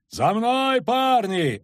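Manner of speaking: high, drawn-out rallying cry; yelling. A narrator's reading. Kagyr calls the lads to follow, yelling. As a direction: yell to lead the charge. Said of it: "орал Кагыр".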